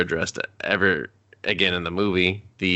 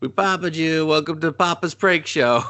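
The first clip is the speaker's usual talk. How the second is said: high-pitched mock host announcement; hammed-up reveal to the prank victim; prank show host; 8 semitones higher than usual.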